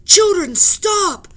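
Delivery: high, very loud command; stern shout. Acted. Professor shouts after the running children, very loud. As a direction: shout them to a halt. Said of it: "professor shouted".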